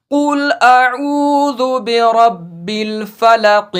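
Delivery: slow, drawn-out Quranic recitation. Shown as a demonstration. Teacher recites Surah Falaq to students in slow recitation. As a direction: chant the verse slowly like tilawat. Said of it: "यहाँ सूरतुल फलक में लिखा है".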